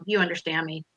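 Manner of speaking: ordinary talk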